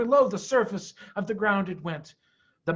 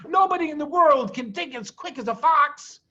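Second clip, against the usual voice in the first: higher, louder boast; proud shout; 9 semitones higher, 5 dB louder than usual. A narrator's reading. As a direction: cheer the family on. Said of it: "shouted Mister Fox".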